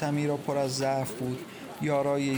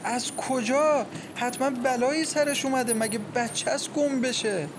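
high, distraught questioning; panicked; 9 semitones higher than usual. A narrator's reading. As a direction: fear the worst aloud, pitch way up. Mother panics over missing daughter.

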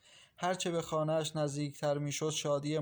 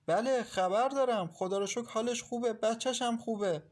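joyful news; 7 semitones higher than usual. A narrator's reading. Sharing good news with grandmother.

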